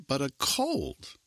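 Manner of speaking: high, drawn-out COLD, falling at the end; high and slow on "cold"; repeating in disbelief. A narrator's reading. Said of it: "Shoshana Cantor repeated".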